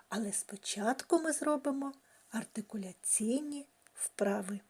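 usual speaking voice

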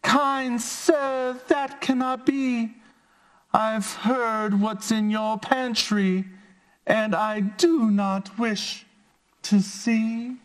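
high squeaky fly voice; tiny polite high voice. Acted. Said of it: "said the little fly".

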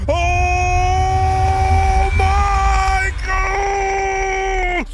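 long drawn-out shriek of fright; terrified scream. Real, not acted. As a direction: scream it in pure terror. Jump scare in horror game.

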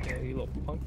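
limp, soft little insult; flat insult. Real, not acted. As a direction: mumble the taunt with no bite. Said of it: "you gotta say that with, like, more, more emphasis".

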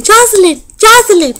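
put-on high little-girl voice calling the name. The little girl calling out to her, high and childlike. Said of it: "this little girl saying".

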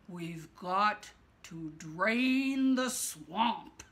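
slow, weighty declaration; slow, grave. Acted. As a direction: lay each word down slowly and firmly. Donald's big announcement.

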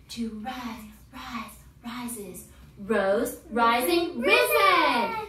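teacher building the chant from quiet to loud; soft to loud build. Shown as a demonstration. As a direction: start soft and climb to a loud call. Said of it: "start low and we're gonna whisper ... get louder".

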